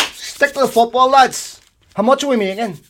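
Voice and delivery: loud, high, hammed-up dismissal; mock jovial executives. Football executives waving away deaths, loud mock-hearty voice. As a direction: call it out heartily, brushing off the issue.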